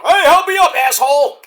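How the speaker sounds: a hammed-up call for a hand up; voicing Edge. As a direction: Edge asking Dax for help, mock-voiced. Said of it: "Edge tries to hold his hand out".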